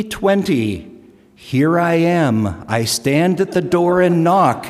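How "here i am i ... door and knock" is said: scripture reading. Retold or imitated. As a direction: read it like a proclamation building up. Quoting scripture, punchline.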